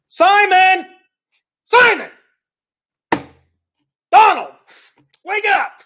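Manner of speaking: rising calls, loud and high; calling out. Acted. Jesus calling loudly to wake a distracted Peter. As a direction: call a daydreamer back to attention.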